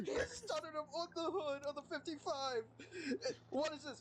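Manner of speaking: words broken by real laughter; laughing while talking. Real, not acted. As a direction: call the play through giggles. Laughing while calling the fight.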